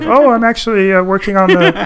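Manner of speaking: high, hesitant caricature voice; George Lucas. Retold or imitated. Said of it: "Why does George Lucas always sound like that".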